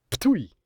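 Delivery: sharp loud high spit; spitting sound. Pretend. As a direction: spit in disgust, ptui. Spitting at the capitalist West.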